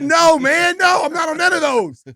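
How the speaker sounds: high, loud exasperated "no, man"; exasperated shout. Retold or imitated. A young player yelling back at his coach, fed up.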